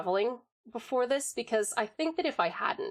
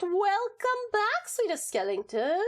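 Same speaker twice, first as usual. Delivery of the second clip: high, slow, drawn-out greeting; a high, slow welcome sung out to a returning viewer; sing-song welcome; 10 semitones higher, slower than usual. Real, not acted.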